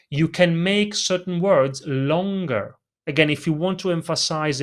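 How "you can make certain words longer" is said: normal talking voice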